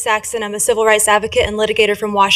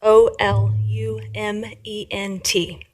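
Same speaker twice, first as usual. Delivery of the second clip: slow letter-by-letter spelling; slower than usual. Shown as a demonstration. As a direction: spell it out slowly, each letter separate. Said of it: "the emolument is spelled".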